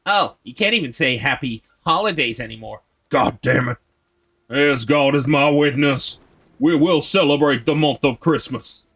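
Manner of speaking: put-on southern accent. Streamer mocking holiday complainers, in an indignant southern accent. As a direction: declaim it in a hammed-up southern American accent. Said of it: "that's some good southern accent".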